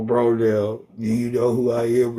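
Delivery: normal talking voice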